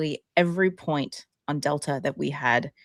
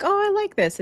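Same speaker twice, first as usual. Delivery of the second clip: delighted quote; 3 dB louder, faster than usual. Retold or imitated. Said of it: "I'm like".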